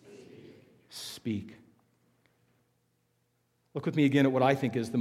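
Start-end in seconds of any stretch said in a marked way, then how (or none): 0.0-1.6 whispered call. Shown as a demonstration. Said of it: "Everybody say".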